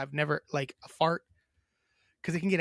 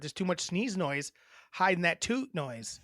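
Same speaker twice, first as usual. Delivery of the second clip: usual speaking voice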